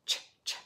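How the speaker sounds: unvoiced ch ch puffs; whispered ch sounds. Shown as a demonstration. Reading to children, softly imitating a child's ch sounds. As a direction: breathe out short ch noises. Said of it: "make sounds".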